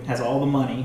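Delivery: ordinary talk, nothing marked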